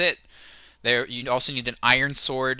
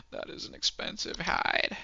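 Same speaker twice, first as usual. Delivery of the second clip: hushed, awed whisper; 7 semitones higher, 6 dB quieter than usual. Real, not acted. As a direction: breathe it out in disbelief. Comment on a high price.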